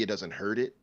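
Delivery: ordinary talk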